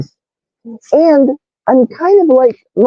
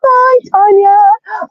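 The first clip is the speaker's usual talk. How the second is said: singsong hello; 13 semitones higher, slower than usual. Real, not acted. Greeting chat viewers in singsong.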